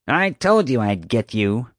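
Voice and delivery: slow menacing snarl. A narrator's reading. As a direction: snarl it with rage. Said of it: "she snarled".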